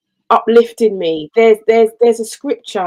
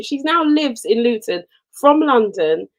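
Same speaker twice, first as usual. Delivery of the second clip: ordinary talk, nothing marked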